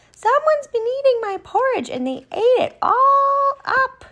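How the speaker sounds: very high little bear squeal; squeaky, upset bear cub stretching the last words; wee small Baby Bear. Acted. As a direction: wail it as a tiny baby bear. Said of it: "in his wee small voice".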